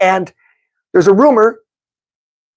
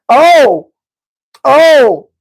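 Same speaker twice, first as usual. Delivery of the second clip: loud, high excited "Oh!"; excited shout; 4 semitones higher, 5 dB louder than usual. Real, not acted. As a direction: burst out hyped. Team matchup excitement.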